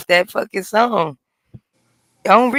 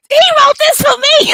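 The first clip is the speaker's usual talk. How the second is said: much higher swooning falsetto; swooning fan; 6 dB louder than usual. Retold or imitated. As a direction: gush like a smitten fan.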